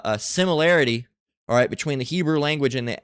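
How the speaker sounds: usual speaking voice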